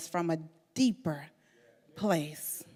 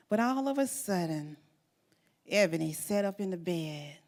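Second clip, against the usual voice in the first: usual speaking voice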